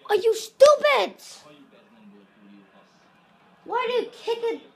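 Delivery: high, loud, exasperated yell at a player; angry outburst. Real, not acted. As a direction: blast the player for a dumb move. Furious at own player's mistake.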